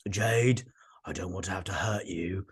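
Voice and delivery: hushed, breathy threat from the villain; menacing whisper. Retold or imitated. Valentine warning Jade off, in a low menacing whisper. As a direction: whisper it like a warning you mean.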